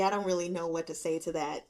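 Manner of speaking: ordinary delivery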